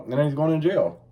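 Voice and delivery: ordinary talk, nothing marked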